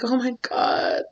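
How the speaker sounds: high, soft tearful gasp of joy; overcome with joy. Acted. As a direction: breathe it out, overwhelmed. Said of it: "I'm about to cry".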